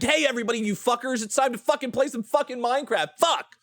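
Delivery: high, hyped streamer greeting, ending in a shouted curse; Vivziepop-style stream intro. Retold or imitated. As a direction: bounce in like a hyped streamer and blow up at the end. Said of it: "doing the Vivzy Pop thing".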